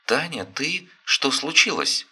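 an alarmed grandmother answering; worried old mother. Acted. Worried mother-in-law on phone.

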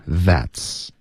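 THAT'S slowly with a clear "th"; touch tongue to teeth to begin THAT'S. Shown as a demonstration. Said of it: "碰上齿". (示范：that's 咬舌音)

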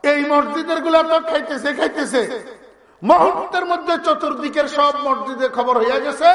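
ordinary delivery

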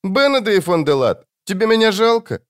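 put-on high, childlike plea; child-voiced demon asking for pity; Жемчуг. A narrator's reading. Demon's parting question to the fleeing wizard, high child voice. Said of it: "Жемчуг снова заговорил".

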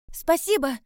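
high, loud call; shouted thanks. A narrator's reading. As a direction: call back while rushing away. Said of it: "крикнула я".

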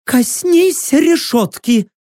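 high, drawn-out cry; shouted command. A narrator's reading. Prisoner commanding the stranger, high shouted order. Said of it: "велел кричащий голос".

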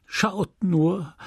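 sighing. Acted. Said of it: "sagte seufzend die Wirtin".

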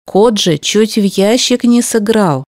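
ordinary delivery, nothing marked

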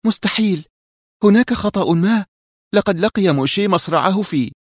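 high female voice, crying out in disbelief; Mona in disbelief. A narrator's reading. Mona cries out in disbelief that Moshe lives, high voice. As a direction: refuse to believe it. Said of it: "وهتفت منى في استنكار".

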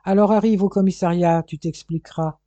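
detective ordering the suspect aloud to the station; loud order. Acted. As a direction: order him aloud to move. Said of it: "ordonna Corfe à voix haute".

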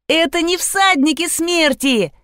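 high, gleeful correction; excited child. A narrator's reading. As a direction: bounce through it like a happy girl. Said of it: "запрыгала Лизавета". (радостно, высоким детским голосом)

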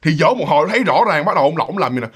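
ordinary delivery, nothing marked

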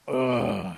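loud groan of getting up; effortful groan. Acted. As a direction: groan as you heave yourself upright. Possum struggles to get up.

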